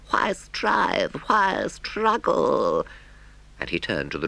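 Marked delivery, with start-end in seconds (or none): 0.1-2.9 high, slow dramatic Toad voice; fateful Toad. Acted. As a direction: surrender to temptation in a high, drawn-out voice. Toad talks to himself.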